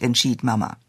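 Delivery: ordinary delivery, nothing marked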